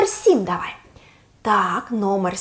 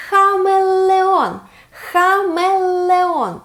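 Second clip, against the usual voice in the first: slow model word; 7 semitones higher, much slower than usual. Shown as a demonstration. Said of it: "Повтори ще раз".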